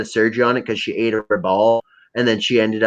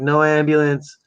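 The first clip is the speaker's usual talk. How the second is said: put-on drunk refusal; 5 semitones higher than usual. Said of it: "I'm like".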